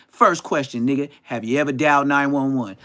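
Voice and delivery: loud, barking interrogator; shouting the first question; mock interrogation shout. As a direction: grill him like a hostile examiner. Mock lie detector interrogation.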